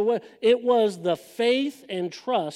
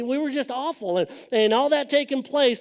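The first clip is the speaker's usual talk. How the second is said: ordinary delivery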